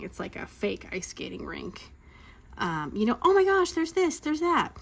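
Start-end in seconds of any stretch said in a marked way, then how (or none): 3.2-4.8 high, gushing imitation; mock excitement. Mom retelling the park's many attractions, mock excitement. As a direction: gush high over every new sight.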